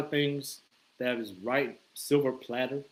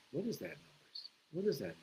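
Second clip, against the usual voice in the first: much softer, curious under the breath; quiet nervous question; hushed wondering; 12 dB quieter than usual. Retold or imitated. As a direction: whisper it to yourself in the dark. Hearing noise in dark street.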